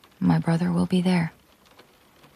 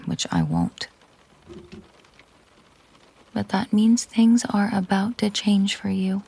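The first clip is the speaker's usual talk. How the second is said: usual speaking voice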